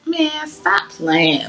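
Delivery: high, drawn-out brush-off; oh come on, dragged out and high; playful disbelief. Retold or imitated. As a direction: stretch it out in mock disbelief. Disbelieving reply to friend's teasing.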